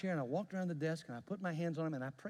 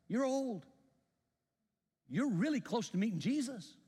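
put-on higher, louder blunt warning to an old man; brazen; 10 semitones higher, 4 dB louder than usual. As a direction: push it boldly, half teasing, half stern. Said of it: "I was a little brazen".

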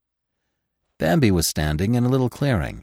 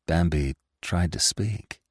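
usual speaking voice

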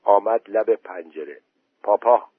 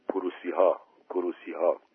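frightened hush; 3 semitones lower, 7 dB quieter than usual. A narrator's reading. Scared boy whispers to girl.

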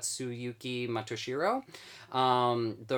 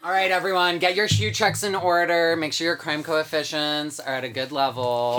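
announcer voice; 5 semitones higher, 7 dB louder than usual. Acted. Announcer-style show opener.

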